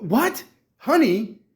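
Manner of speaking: high, baffled outburst; incredulous. Real, not acted. Diabetic reacts to being prescribed honey, incredulous.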